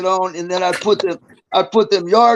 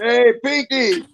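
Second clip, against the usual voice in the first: drawn-out teasing holler at a cellmate; yelled call; 6 semitones higher, slower than usual. Retold or imitated. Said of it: "I used to yell around the chair".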